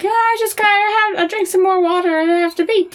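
put-on high-pitched child making excuses; little kid. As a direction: play your younger self, innocently fibbing to Mom. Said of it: "when I was little".

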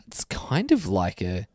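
soft slow whisper. Real, not acted. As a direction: breathe it out under your breath. Whispered aside.

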